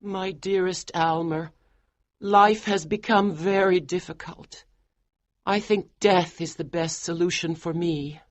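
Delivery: high, soft and resigned; wife telling husband she prefers death; weary wife. Acted. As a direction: speak of death quietly, resigned. Georgiana weary.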